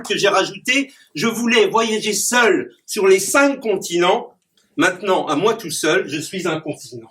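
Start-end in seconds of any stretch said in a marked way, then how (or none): 1.2-7.1 declaimed rhyming verse with punchy rhyme endings; poem recitation. Acted. Clown reciting his rhyming poem to the audience. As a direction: perform the poem for the audience, landing each rhyme.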